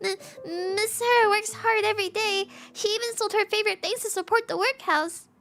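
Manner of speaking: milk the pity for the visitors; child begging sponsors in a high pleading voice; overblown pleading. Acted. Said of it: "Sarah's exaggerated theatrics".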